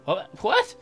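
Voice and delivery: usual speaking voice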